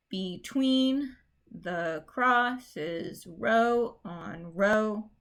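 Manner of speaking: slow reading, "Between" stressed be-TWEEN; iambic reading. Shown as a demonstration. Teacher reading the line in iambic beat. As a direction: beat the iambs slowly. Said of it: "in iambic".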